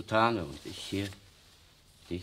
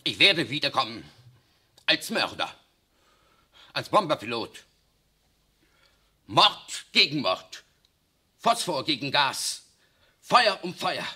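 loud, higher, raging vow of revenge; shouting vengeance, Mord gegen Mord slowed and hammered; furious threat; 4 semitones higher, 6 dB louder than usual. Acted. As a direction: hurl each phrase as a threat.

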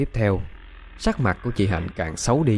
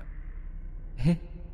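eerie soft giggle; 6 semitones higher, 11 dB quieter than usual. Acted. Possessed girl giggles eerily.

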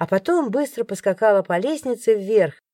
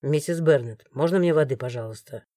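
lower, softer, firm male voice; guard interrupts; 6 semitones lower, 4 dB quieter than usual. A narrator's reading. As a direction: cut in low and firm. Morgan asks old lady. (низко и твёрдо перебивает)

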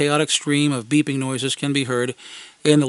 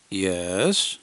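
guarded; 6 semitones lower, 4 dB quieter than usual. Retold or imitated. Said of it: "guarded concern".

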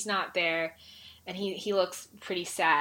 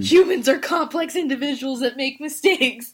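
loud, high exasperated groan into a declaration; worked-up exasperation, louder and higher; exasperated outburst; 5 semitones higher, 8 dB louder than usual. Real, not acted. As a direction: blurt it out, loud and overwhelmed. Exasperated outburst about flawed characters.

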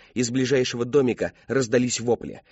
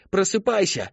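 higher, urgent wake-up call; 8 semitones higher than usual. Acted. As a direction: shout to wake him. Calling to the unconscious man.

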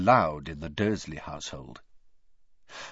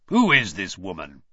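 low, louder, disgusted question; distaste; 5 semitones lower, 6 dB louder than usual. A narrator's reading. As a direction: spit it out with distaste. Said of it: "staring at the signature with distaste".

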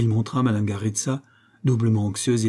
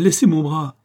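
higher, louder plea to let go; urgent protest; 6 semitones higher, 6 dB louder than usual. A narrator's reading. Woman pulling from the master's grip, urgent protest. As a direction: demand release, pitch and volume up. Woman struggles to free her arm.

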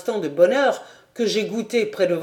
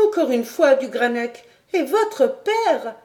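put-on higher young woman's voice, urgent and pleading; Clara pleading; 8 semitones higher than usual. Clara begging du Granec not to leave, higher pleading voice. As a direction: beg him to stay, voice lifted.

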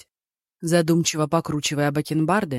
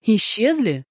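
high, louder disbelieving echo; astonished; 6 semitones higher, 4 dB louder, slower than usual. A narrator's reading. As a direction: repeat it in shock. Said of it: "воскликнул судья".

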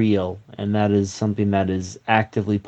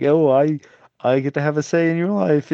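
mock parent; 5 semitones higher than usual. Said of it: "these people that are just like".

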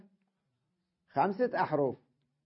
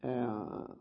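ordinary delivery

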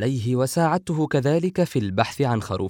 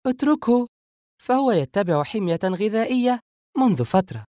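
high-pitched, knowing woman's voice; winking tease; 10 semitones higher, 4 dB louder than usual. A narrator's reading. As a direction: tease him in a high, light voice. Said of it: "سارة غامزة بعينها". (مداعبة ماكرة بصوت عال)

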